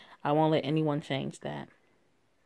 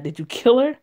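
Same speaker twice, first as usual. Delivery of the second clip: shocked shout; 7 semitones higher, 7 dB louder than usual. Acted. Friend finds beaten girl.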